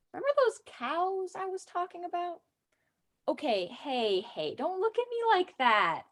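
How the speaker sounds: chatty, teasing tour-guide pitch to a newcomer; cheeky tour guide. Acted. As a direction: sell the town with a wink. Comic Vermont tour-guide monologue read aloud.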